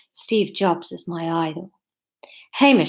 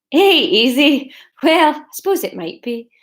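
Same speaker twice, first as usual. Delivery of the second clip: put-on higher, imperfect Scottish accent; slightly hammed-up Scottish accent; 4 semitones higher, 3 dB louder than usual. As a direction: protest in an amateur Scottish accent. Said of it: "distinguishable from the Scottish accent".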